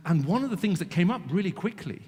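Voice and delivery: ordinary delivery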